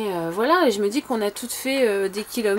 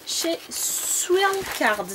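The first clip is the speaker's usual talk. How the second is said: slow, high, careful English accent on "Swirl Cards"; 6 semitones higher, much slower than usual. Real, not acted. To viewers, naming the brand, slow English accent. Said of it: "Excusez mon accent pourri".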